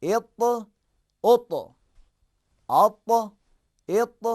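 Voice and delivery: loud, heavy اط drill. Shown as a demonstration. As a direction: hit the heavy ط in اط again and again. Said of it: "تینوں حرکات پہلے لگا کر".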